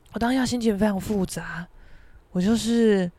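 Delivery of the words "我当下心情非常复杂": plain reading voice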